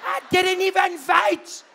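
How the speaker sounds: very high, loud shocked voice; disbelieving panic. Retold or imitated. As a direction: shriek in disbelief.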